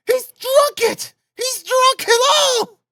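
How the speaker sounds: very high, drawn-out shriek; hysterical, slow high wail; despairing scream. A narrator's reading. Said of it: "the poor woman screamed in despair".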